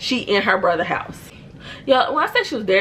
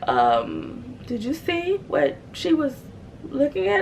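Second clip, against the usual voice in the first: put-on shaky boyfriend; 6 semitones higher, 4 dB quieter, slower than usual. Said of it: "And he's like".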